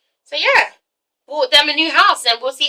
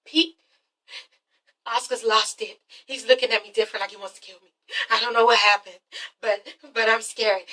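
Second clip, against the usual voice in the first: hushed, frightened pleading; scared; 8 dB quieter than usual. Retold or imitated. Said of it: "She's just fucking scared as shit".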